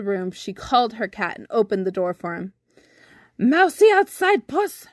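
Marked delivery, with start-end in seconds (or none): 3.4-4.9 put-on high, loud old lady calling; old woman voice. A narrator's reading. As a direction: call the cat, high and shrill. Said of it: "she said".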